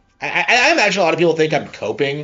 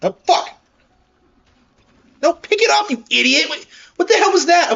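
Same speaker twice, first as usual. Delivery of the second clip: high, loud frustrated yelling; raging gamer; 6 dB louder than usual. Real, not acted. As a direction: scream at the game in rage. Game glitch, yelling at screen.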